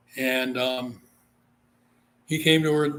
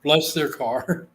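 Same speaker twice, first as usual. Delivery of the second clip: ordinary delivery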